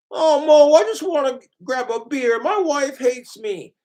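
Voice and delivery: high, whining cartoon husband; Homer Simpson. Homer complains to bartender about wife, high whine. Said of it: "My Simpsons voices".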